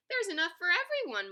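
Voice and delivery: high, loud merry call; chuckling. A narrator's reading. Mole offering nuts to all, cheerful chuckling manner. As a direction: announce it merrily. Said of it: "Mole chuckled".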